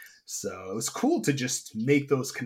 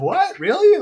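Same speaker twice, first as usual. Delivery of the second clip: high, loud disbelieving what, really; a burst of startled disbelief; surprised; 9 dB louder than usual. Retold or imitated. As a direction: replay the shock of being recognised. Retold surprise at being recognised.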